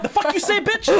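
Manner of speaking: a high, loud mock threat; mock tough retort. Joking about a girlfriend with a click accent, mock tough retort. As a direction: snap it back like an insulted tough girl. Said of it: "You're like".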